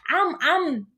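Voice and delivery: ordinary talk, nothing marked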